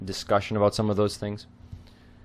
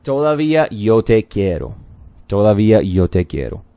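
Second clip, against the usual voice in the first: louder lyric reading of "Todavía yo te quiero"; 8 dB louder than usual. Shown as a demonstration. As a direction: project the chorus line "Todavía yo te quiero" clearly. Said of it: "so the chorus starts off".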